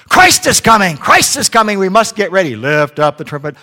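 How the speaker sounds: high, loud parrot preaching. Acted. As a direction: blast it out like a squawking preacher. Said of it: "They heard".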